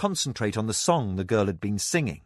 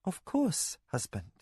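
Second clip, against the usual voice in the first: quiet submission; 7 semitones higher, 7 dB quieter than usual. Acted. Mara submissive reply.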